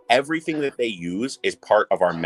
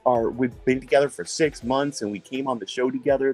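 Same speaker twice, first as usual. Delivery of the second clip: higher put-on small-talk voice; imitated chatty couple; 5 semitones higher than usual. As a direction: mimic an overly friendly stranger. Planted couple introduces itself, retold.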